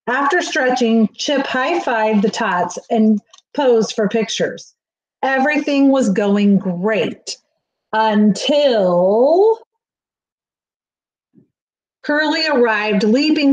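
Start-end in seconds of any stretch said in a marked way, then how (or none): none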